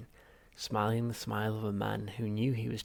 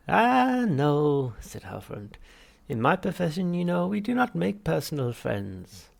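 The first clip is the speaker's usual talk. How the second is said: much higher, louder unctuous therapist; Halfrunt voice; 7 semitones higher, 9 dB louder, slower than usual. Acted. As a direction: greet the patient with oily cheer. Psychiatrist voiced.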